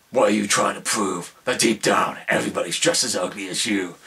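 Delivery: put-on Batman voice quoting his line; Batman impression. Reviewer quoting Batman's line, put-on Batman voice. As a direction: say it as the movie's hard-to-follow Batman. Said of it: "I'm not sure how understandable my voice is".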